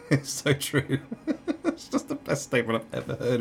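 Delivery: high, amused, breaking into laughs; really tickled, laughing as you speak. Real, not acted. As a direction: talk through real laughter. Laughs at the quote just read.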